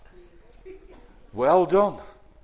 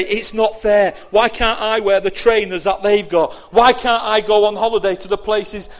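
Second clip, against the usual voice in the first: envious whining; 4 semitones higher, 7 dB louder than usual. Acted. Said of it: "they say".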